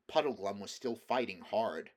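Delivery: plain reading voice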